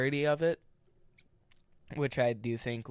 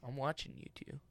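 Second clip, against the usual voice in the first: hushed mock threat; whispering; 2 semitones higher, 9 dB quieter than usual. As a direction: whisper it like a warning. Mock threat after name joke.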